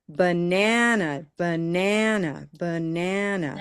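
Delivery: "banana" with a long NAN and short schwas; steady stressed-syllable drill. Shown as a demonstration. Teacher leading the class in practice, steady stretched stress. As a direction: chant "banana" in an even beat, stretching the middle syllable. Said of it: "just consistent".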